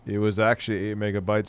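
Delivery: usual speaking voice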